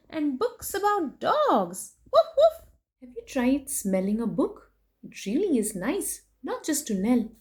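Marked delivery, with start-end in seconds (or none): 2.1-2.7 high, loud playful woof woof; barking dog. Acted. Reading to kids, a hammed-up puppy bark.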